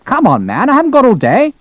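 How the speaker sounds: high-pitched, impatient finish; impatient colonel. A narrator's reading. Colonel hurrying a nervous doctor, impatient. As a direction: climb sharply into exasperation. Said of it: "prompted an impatient flap".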